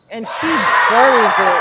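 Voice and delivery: loud, slow, drawn-out call of the big shot; excited call. Real, not acted. Commentator to fans, excitedly calling the go-ahead three. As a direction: belt out the three going in. Clutch three-pointer in close game.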